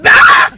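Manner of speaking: very high, loud angry shriek; frustrated scream. Real, not acted. To viewers, still furious, screaming it out. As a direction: let out a scream of rage. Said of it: "I'm still pissed!".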